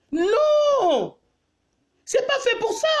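indignant cry. Real, not acted. Indignant protest against cloth masks.

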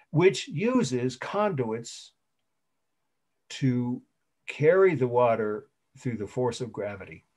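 slow dictation with long pauses; drawn-out, deliberate phrases. Real, not acted. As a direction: dictate it piece by piece for students writing. Dictating a definition to students.

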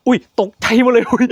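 jumped, high and loud with a laugh; real fright that turns to a laugh; startled squeal then nervous laughter; startled yelp. Real, not acted.

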